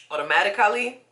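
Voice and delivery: automatically said with West African vowels and rising tune; automatically in a light West African accent, ending up. Real, not acted. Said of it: "I sound so African".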